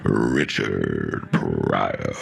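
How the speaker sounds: imitating a big stage introduction; announcer intro. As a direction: stretch out the name like a hype man. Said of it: "his man goes".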